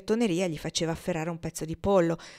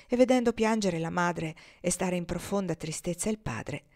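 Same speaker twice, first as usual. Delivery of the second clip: ordinary delivery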